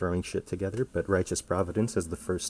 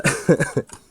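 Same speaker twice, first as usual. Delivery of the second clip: airy laugh while out of breath; breathless laugh; 8 semitones higher, 10 dB louder than usual. Real, not acted. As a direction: laugh it off, winded. Laughing breathlessly after close fight.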